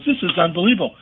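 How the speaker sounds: retold amazement, pitched high; mock disbelief. Manager marvels at his player after the game, mock disbelief. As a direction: imitate a manager who is astounded.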